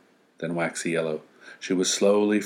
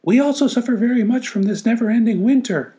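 very high, louder squeaky critter voice; little forest animals complaining; small animals; 5 dB louder than usual. Acted. Animals speaking.

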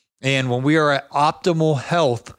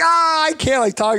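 imitating pained screaming; 13 semitones higher than usual. Friend with pulled back muscle, mock pained scream. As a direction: squeeze it out like you're in agony. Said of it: "He's screaming".

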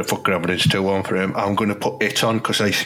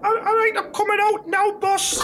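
much higher squeaky player voice; timid young player. Acted. Player calling to his manager from the toilet, high timid voice. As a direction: answer the boss meekly from the toilet.

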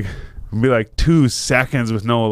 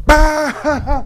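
imitated high, loud burst of laughter; sudden laughing outburst; 5 dB louder than usual. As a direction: burst out with a mock guffaw. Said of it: "we start fucking dying laughing".

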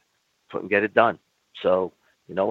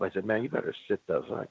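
normal talking voice

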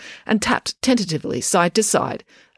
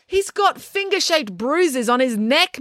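higher, slow, pointed retort; snapped back; 9 semitones higher, slower than usual. A narrator's reading. Mister Lang to April about Tom's bruises, snapping back. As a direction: throw it back at her sharply. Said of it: "Mister Lang snapped back".

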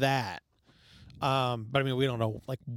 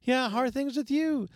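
mock polite small talk; 9 semitones higher, slower than usual. Mocking forced small talk.

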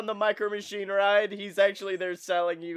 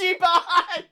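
high, loud gleeful cry; excited shout; 13 semitones higher, 9 dB louder than usual. Pretend. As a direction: shout it out in mock hype. Excitedly echoes co-host's ride name.

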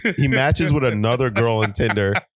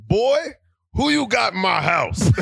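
high-pitched mock yell of an outraged father; acted dad's yell; 9 semitones higher than usual. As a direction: play a dad hollering at his son. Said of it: "And he yells".

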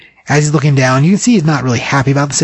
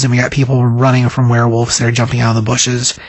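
ordinary talk

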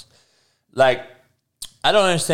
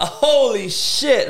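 laughing; 8 semitones higher, much slower than usual. Real, not acted. Laughs at a doll picture.

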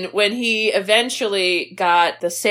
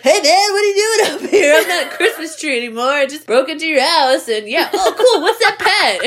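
put-on goofy kid voice; 5 semitones higher than usual. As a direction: play a giddy, clueless boy. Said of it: "Corey Feldman's like".

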